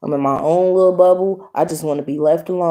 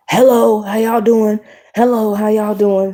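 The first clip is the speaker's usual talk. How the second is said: imitated higher-pitched hello; pushy greeting; 4 semitones higher than usual. Stranger greets a couple at McDonald's, imitated pushy greeting. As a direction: mimic an over-friendly stranger calling over.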